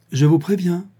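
mildly brusque notice. A narrator's reading. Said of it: "dit brusquement Liane".

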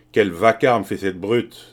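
loud, slow, emphatic outrage; forceful, irritated outburst; indignant exclamation. A narrator's reading. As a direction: exclaim loudly, drawing out the complaint. Man exclaims at beaten man's noise.

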